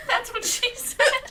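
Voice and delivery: slow, laughing punchline. Real, not acted. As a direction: squeeze it out mid-laugh. Joke through laughter.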